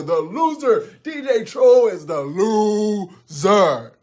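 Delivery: loud, high-pitched fan frenzy; excited crowd shouting. Acted. Crowd cheers the goats and mocks DJ Troll, excited shouting.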